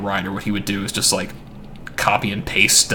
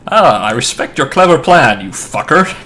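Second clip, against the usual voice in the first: sarcastic; 4 semitones higher, 6 dB louder than usual. Retold or imitated. Mock paraphrase of villain's letter.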